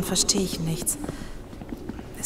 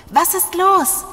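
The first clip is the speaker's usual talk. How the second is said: much higher and louder, alarmed; anxious urgent call; 10 semitones higher, 8 dB louder, slower than usual. Acted. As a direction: call up urgently, fearing the worst. Friend anxiously asks climber what's wrong.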